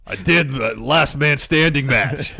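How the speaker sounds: slow, loud, hammed-up wrestler bellow; mock wrestler shout, drawn out; Braun. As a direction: declare it like a boastful wrestler. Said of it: "is interviewed by Corey".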